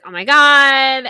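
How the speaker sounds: loud, high, drawn-out exclamation; mock alarm. Jen learns her crush was texted, mock alarm. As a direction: draw it out in imitated panic. Voices Jen's alarm at text.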